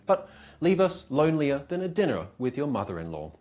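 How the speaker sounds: normal talking voice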